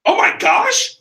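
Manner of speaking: loud, high-pitched gasp of amazement; astonished mom. Retold or imitated. Mom seeing the knife cut rope, astonished. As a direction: exclaim like a wowed mom. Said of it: "She goes".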